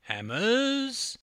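high, loud, cheeky toss-off; cocky flippant reply. A narrator's reading. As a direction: throw it away flippantly. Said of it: "replied John flippantly".

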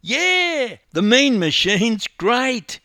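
high, loud, slow chorus-style cheer; boys chorusing. A narrator's reading. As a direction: echo the cheer like a gang of young mates. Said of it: "chorused his three mates".

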